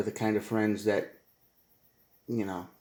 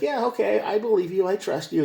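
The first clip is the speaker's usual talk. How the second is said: higher, gullible agreement; naive heart; 9 semitones higher than usual. Retold or imitated. Heart believing the lying partner, high gullible voice. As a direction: give in like a trusting fool.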